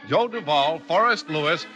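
usual speaking voice

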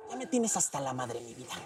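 low, soft menacing mom; a mother quietly warning her daughter, menacing; low threat. Acted. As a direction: drop the voice to a simmering threat.

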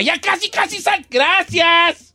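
gracias blurted out high through laughter; laughing shout. Retold or imitated. Host acts out the clumsy exit, laughing and shouting gracias.

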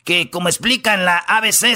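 ordinary talk

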